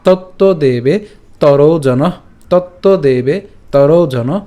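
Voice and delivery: slow phrases with stretched vowels; broken-up recitation. Shown as a demonstration. Teacher drills the split verse, slow careful recitation. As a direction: repeat each piece slowly and distinctly. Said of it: "এই ভাঙা অংশটুকু আপনারা এইভাবেই পাঠ".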